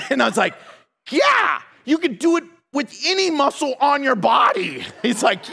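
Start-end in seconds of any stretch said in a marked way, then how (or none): 1.1-4.8 high, hollered egging-on; exaggerated pep-talk shout; mock hyped-up cheer. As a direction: cheer like an overexcited lifter. Imitated hype from strongmen.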